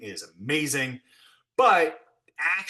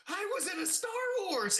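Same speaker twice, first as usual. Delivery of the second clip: high, soft, stunned little voice; childlike awe; 5 dB quieter than usual. Retold or imitated. The blacksmith telling his wife Lennon about his scene, high soft childlike awe. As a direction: marvel like a starstruck kid. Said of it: "I look at her and go".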